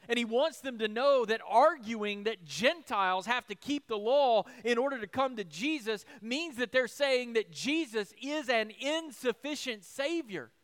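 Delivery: usual speaking voice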